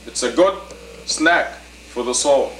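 usual speaking voice

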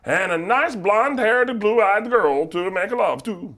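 put-on high, loud sing-song Swedish accent. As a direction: play a cartoon Swedish man, slow and high. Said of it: "your impression of what you believe a Swedish".

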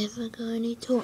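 quick unvoiced whisper. Real, not acted. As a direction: whisper the question fast. Mutters aside while playing.

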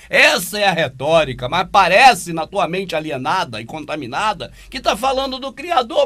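loud, high, insistent denunciation; emphatic rebuttal. Real, not acted. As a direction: raise the voice and drive the point home, stretching the last words. Heated rebuttal of the played preacher.

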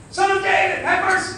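high-pitched imitation of a desperate cry; imitated scream. Blind Bartimaeus calls to Jesus over the crowd, imitated scream. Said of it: "Bartimaeus starts screaming".

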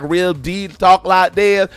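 deep Southern talk. Retold or imitated. Mocking how her Southern relatives talk, slow thick Southern accent. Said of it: "cousins and relatives that talk real Southern".

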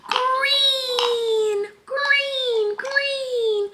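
very high drawn-out call; high sing-song word. Real, not acted. Mom teaching her baby colors, very high sing-song.

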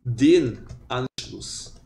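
den Anschluss drawn out very slowly; teacher modeling the accusative slowly; slow demo. Shown as a demonstration. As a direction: stretch "den Anschluss" word by word. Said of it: "e fica".

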